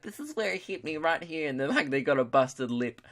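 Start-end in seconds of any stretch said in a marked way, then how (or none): 0.0-1.5 soft, higher-pitched mock fan; proud fan. Fan shows off where the idol punched, hushed imitation.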